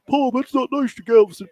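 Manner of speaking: a hammed-up local taking offence; mock offended listener. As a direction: squeal it like a hurt hometown fan. Voicing offended Galveston listener.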